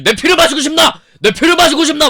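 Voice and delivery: high, loud hammed-up vampire; imitating a vampire shouting at the mother; creepy vampire voice. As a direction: taunt like a deranged vampire. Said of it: "이상한 새끼인 거지".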